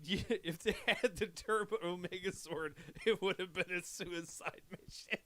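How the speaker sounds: soft, chuckling through the words; laughing quietly. Real, not acted. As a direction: laugh under your breath while talking. Laughing while reading chat.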